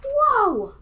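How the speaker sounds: high, loud whoa; amazed. Acted. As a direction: wow the little ones. Magic reveal.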